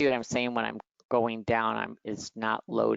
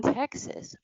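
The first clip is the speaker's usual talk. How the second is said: mock disbelief; 11 semitones higher than usual. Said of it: "And I'm like".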